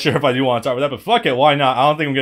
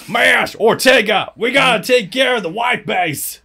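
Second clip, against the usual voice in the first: put-on Texas accent; 9 semitones higher, 5 dB louder than usual. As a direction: bark the order like a Texan soldier. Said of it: "Canadians doing stereotypical Texas voices".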